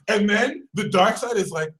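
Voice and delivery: ordinary talk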